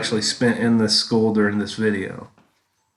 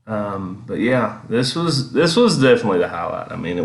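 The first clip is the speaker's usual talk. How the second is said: ordinary talk, nothing marked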